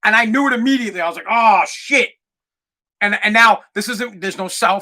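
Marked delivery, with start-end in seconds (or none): 1.3-2.1 drawn-out "oh" of sinking realization; dismayed outburst. Retold or imitated. Teller realizing the engine is dead, dismayed outburst.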